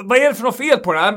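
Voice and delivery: high, loud, fast demanding customer; irritated customer. Retold or imitated. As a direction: rattle it off like an annoyed customer.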